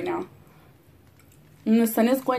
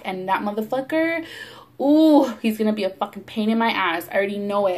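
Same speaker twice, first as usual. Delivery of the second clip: ordinary talk, nothing marked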